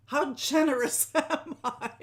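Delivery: slow, loud mock boast with a laugh; joking self-praise. Host teasing viewers about her gifts, mock boastful. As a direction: brag in jest, drawing it out. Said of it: "I'm just kidding".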